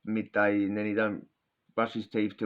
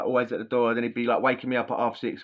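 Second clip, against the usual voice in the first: ordinary delivery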